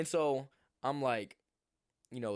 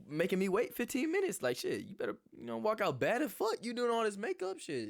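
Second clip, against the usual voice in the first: mock exasperated rant; 10 semitones higher than usual. Ranting at late date.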